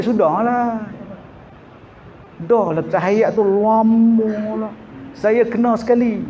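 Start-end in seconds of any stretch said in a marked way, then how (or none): none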